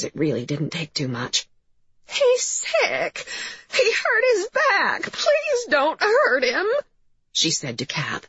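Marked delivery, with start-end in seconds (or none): 2.1-6.7 high, slow tearful wail; plaintive crying.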